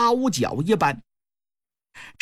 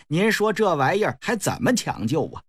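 ordinary delivery

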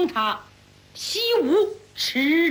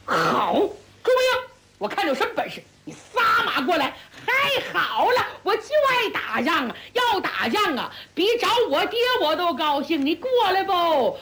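higher, laughing battle taunt; laughing challenge; 6 semitones higher than usual. Acted. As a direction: taunt the enemy with a grin. 梅城/朱木英对阵. (笑着高声叫阵)

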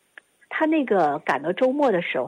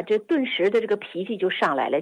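ordinary talk, nothing marked

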